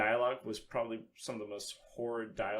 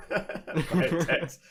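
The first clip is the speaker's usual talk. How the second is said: laughing; 6 semitones higher, 7 dB louder than usual. Real, not acted. Laughing at the text voice.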